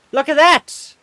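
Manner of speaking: high, loud excited look at that; excited exclaim. Real, not acted. Streamer showing chat cool fan art, thrilled. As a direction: point it out, thrilled.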